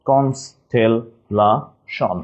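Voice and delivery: very slow "constellation", each syllable apart. Shown as a demonstration. Teacher spelling for class, "constellation" split slowly by syllable. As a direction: break "constellation" into separate syllables, pausing between. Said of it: "just একটু ভেঙে নিব".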